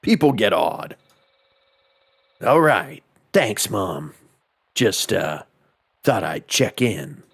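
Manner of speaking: lightly voiced low game-hero voice; David Hayter. Reading the mom's phone call aloud, low impression voice. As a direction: read it low, with a hint of impression. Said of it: "David Hayter impressions".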